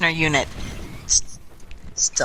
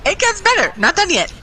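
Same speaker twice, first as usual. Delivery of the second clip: excited. Real, not acted. Teasing the story's next part.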